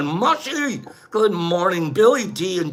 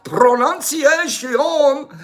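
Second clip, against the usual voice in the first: higher, slow, hammed-up Spanish accent; 5 semitones higher, much slower than usual. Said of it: "even if it's your Spanish accent".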